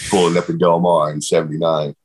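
ordinary talk, nothing marked

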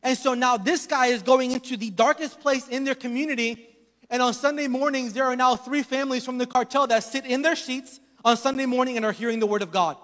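ordinary talk

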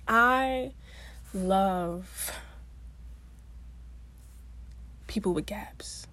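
hushed whispered confession. Real, not acted. Vlogger's hushed tribute to people with gaps, whispered. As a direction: whisper it like a reverent secret.

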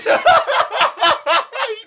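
risa chillona sin control; carcajada muy aguda. Real, not acted. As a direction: soltar la carcajada. Sigue riendo de la caída. (risa aguda y descontrolada)

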